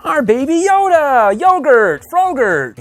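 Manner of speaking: high, loud, drawn-out cheer; excited shout-out. Acted. Host introducing Baby Yoda to viewers, excited. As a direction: announce the mascot in a high, gleeful call. Gleefully announcing the green mascot.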